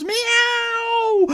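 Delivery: very high wailing meow; high loud cat yowl. Acted. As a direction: wail like a cat. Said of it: "The cats walled".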